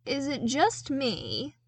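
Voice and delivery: slow and squinting, unhurried. A narrator's reading. Soldier slowly questions the datapad, drawn out and slow. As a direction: draw the words out as if doubting your eyes. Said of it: "Slice began slowly".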